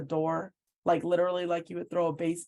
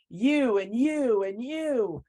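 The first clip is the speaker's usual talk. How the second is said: slow, louder mock yell, jabbing at each one; 5 semitones higher, 5 dB louder, much slower than usual. Coach yelling at the administrators one by one, hammed-up slow yell. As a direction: bark it out slowly as if pointing at each person. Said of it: "He was screaming and yelling".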